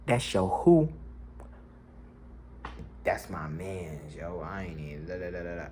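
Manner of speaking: slow, soft, lightly voiced laid-back guy; laid-back friend. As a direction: drawl it, chill and unbothered.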